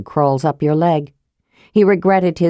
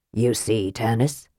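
quiet, much softer reproach; low and chiding, softer than the narration; reproachful; 8 semitones lower, 7 dB quieter than usual. Acted. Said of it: "said Raistlin reproachfully".

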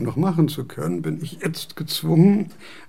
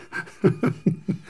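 breathy unvoiced laugh; 4 semitones higher than usual. Kant laughs after his joke.

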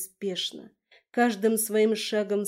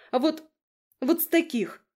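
higher, louder huffy retort; huffy snort; 7 semitones higher, 5 dB louder than usual. Acted. Kira huffs back at the dragon, snorting retort. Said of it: "фыркнула".

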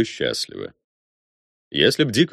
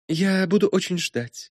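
wistful; 11 semitones higher, slower than usual. Acted. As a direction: let it fall sadly. Sad young woman farewell.

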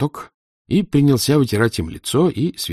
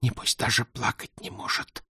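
hushed whisper, barely any voice; 3 semitones higher, 9 dB quieter than usual. A narrator's reading. Aglaya whispering to the boys about Goga. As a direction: breathe it out so the others can't hear. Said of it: "прошептала Аглая".

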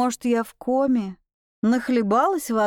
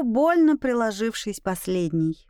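plain reading voice

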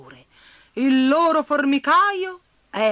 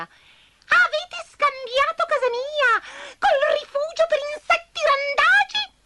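cross little spider; 4 dB louder than usual. Acted. Grumpy spider scolds ants in her doorway. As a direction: tell them off for the racket.

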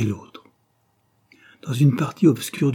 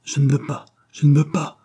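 raised, insistent narrated refusal; struggling refusal; 6 semitones higher, 5 dB louder than usual. A narrator's reading. Caroline trying to break free from Charlotte, insistent refusal. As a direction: refuse twice, louder the second time. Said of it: "répéta-t-elle en cherchant à se dégager".

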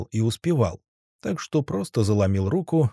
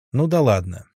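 plain reading voice

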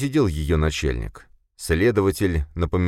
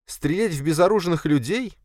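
much higher indignant female-character voice; 13 semitones higher than usual. A narrator's reading. Sofia objects to shooting the unarmed, indignant. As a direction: push back with a high, disbelieving question. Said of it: "возмутилась Софья".

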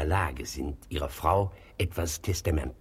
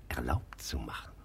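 whispered and softer; sly whisper; 8 semitones lower, 8 dB quieter than usual. Acted. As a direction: drop to a knowing whisper. Notary hints at illegal scheme.

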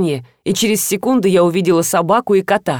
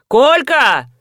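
higher, drawn-out holler; mother's loud summons; calling out; 5 semitones higher, 4 dB louder than usual. Acted. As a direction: holler for someone upstairs. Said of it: "засмеялась Людмила и закричала".

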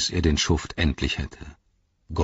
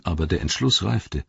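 ordinary delivery, nothing marked

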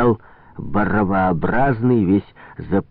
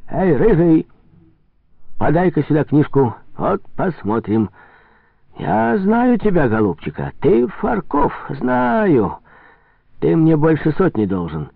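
higher-pitched merchant scolding a debtor; indignant trader, accusing; 8 semitones higher than usual. Acted. Trader calling in Farkov's debt, high accusing voice.

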